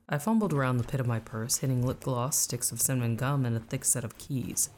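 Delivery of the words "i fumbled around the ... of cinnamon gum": ordinary delivery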